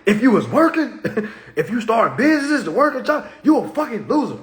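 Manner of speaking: high, loud playful rant with laughs; snapping. Acted. Speaker imagines scolding job holders, snapping in laughing mock outrage. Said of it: "I'ma snap".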